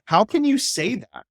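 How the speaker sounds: high, disbelieving question; incredulous. Retold or imitated. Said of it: "And he goes".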